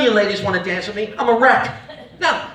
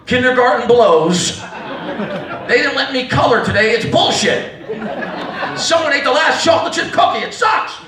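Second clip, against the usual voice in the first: put-on griping little kid; 3 semitones higher than usual. Five-year-old commiserates with drinking dad, mock outraged.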